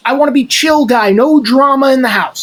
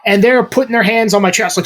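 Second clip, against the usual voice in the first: ordinary talk, nothing marked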